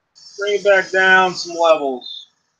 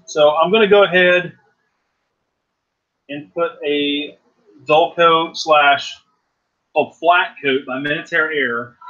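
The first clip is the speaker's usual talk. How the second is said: normal talking voice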